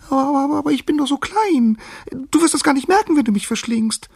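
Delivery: high, loud stammering squeak; squeaking mouse. A narrator's reading. Mouse begging not to be eaten, squeaking. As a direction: plead in a tiny, very high voice. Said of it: "piepste die Maus".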